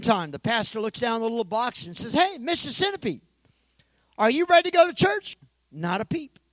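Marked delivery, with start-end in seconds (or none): 2.1-5.4 calling again. Acted. Pastor calls a second time.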